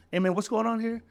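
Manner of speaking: high-pitched gangster-style confrontation; gangster feel. Shown as a demonstration. Cook confronting a coworker shoulder to shoulder, gangster feel. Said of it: "that gangster feel".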